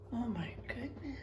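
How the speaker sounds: hushed amazed whisper. Real, not acted. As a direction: whisper it in wonder. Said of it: "whispered wonder to class".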